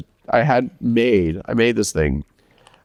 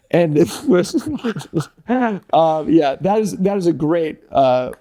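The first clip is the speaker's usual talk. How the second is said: ordinary delivery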